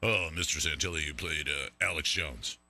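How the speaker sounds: very low, soft official voice; deep put-on voice of a complaining caller; scolding phone caller. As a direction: drop low like a hushed bureaucrat on the phone. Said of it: "pick up the phone and say".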